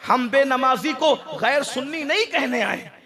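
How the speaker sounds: ordinary talk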